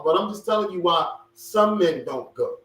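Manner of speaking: ordinary delivery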